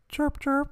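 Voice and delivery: high squeaky chirp chirp; chirping cricket voice. Shown as a demonstration. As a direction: imitate a cricket chirping softly. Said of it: "do the crickets".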